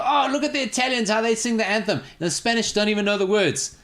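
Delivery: high-pitched mimicry of upset complainers; mock upset fans. Podcast host imitating upset fans during anthems, mock upset. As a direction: put on an indignant fan, pitched right up. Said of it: "people get so upset. They go".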